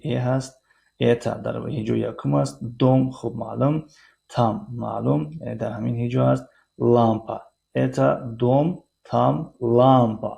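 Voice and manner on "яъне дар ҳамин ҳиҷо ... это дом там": ordinary talk, nothing marked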